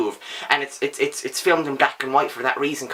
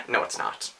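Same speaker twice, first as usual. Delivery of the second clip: hushed, lower, much softer "no, it's not"; whispered denial; 9 dB quieter than usual. Acted. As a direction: breathe it like a betrayed secret. Mock betrayal at the book.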